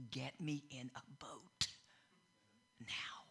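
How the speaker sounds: hushed whisper, weary. Retold or imitated. Jesus quietly begging his disciples for a boat, whispered. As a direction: whisper it like you're worn out by the crowd. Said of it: "he goes".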